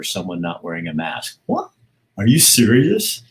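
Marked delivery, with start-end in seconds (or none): 1.5-3.2 higher, louder and slower, drawn-out disbelief; incredulous cry over a fight about masks; stunned disbelief. Real, not acted. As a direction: ask it as if you can't believe your ears. Reacts to people throwing punches.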